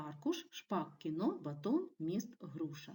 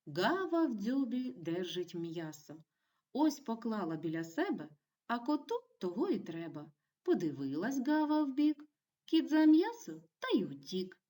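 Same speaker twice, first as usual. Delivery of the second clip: put-on lively poem reading for children, rising to louder, higher peaks; expressive poem reading; 4 semitones higher, 5 dB louder than usual. Teacher reads pupils a poem about a crow, expressive storytelling. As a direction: read the verse to young pupils, building to the punchline.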